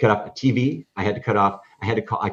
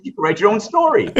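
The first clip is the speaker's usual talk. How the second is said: much higher and louder, playful; excited teasing; 12 semitones higher, 5 dB louder than usual. Retold or imitated. Teasing the kid who became a reporter, excited. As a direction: toss it off with excited delight.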